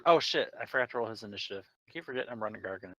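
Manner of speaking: ordinary delivery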